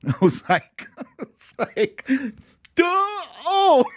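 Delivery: high, laughing through the words; giggling at his own silliness. Real, not acted. Dad laughing at daughter's obvious camera light fix.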